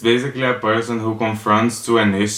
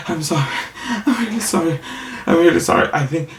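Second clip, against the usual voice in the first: high-pitched tearful apology, imitated; hammed-up weepy voice; mock crying; 7 semitones higher, faster than usual. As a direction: imitate someone apologizing through tears. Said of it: "The person was crying".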